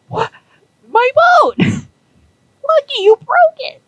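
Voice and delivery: a shocked owner crying out at his broken boat; dismayed shriek. Retold or imitated. Zero's boat just crashed.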